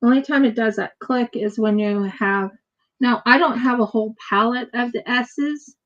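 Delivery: normal talking voice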